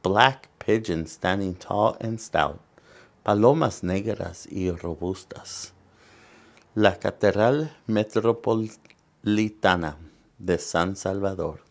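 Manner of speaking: lively bilingual picture-book reading; reading to children. Acted. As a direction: read it out to the kids, bright and clear. Reads picture book aloud.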